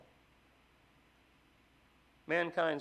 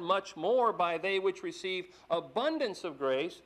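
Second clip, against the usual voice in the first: loud, slow, ringing scripture reading; preacher reading Romans aloud with force; 4 semitones higher, 5 dB louder than usual. Acted. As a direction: proclaim the verses to the congregation.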